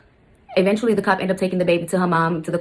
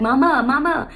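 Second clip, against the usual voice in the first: imitated child's call, pitched up; stepdaughter calling mama; 7 semitones higher, slower than usual. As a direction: call out like a little girl wanting her mom. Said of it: "She started calling me mom".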